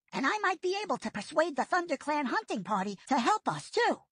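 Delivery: a quick-thinking apprentice making a plan; Firepaw's voice. A narrator's reading.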